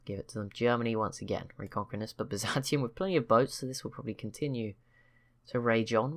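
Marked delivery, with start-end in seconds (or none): 0.1-0.5 breathy whisper, barely voiced; whispered aside. Real, not acted. Commentator whispers while ceding land to Norway and Sweden, whispered. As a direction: whisper it to yourself like a throwaway concession. Muttering a concession to himself.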